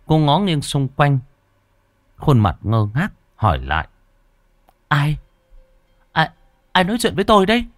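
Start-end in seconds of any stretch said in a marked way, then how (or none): 4.9-7.7 high, frightened, stammering ask; frightened question. Acted. Thúy alone in a strange storehouse, asking fearfully. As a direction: cry it out scared in the dark.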